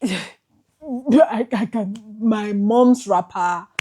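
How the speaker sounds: fighting back tears, breaking; choking up. Real, not acted. Emotional outburst recounting theft.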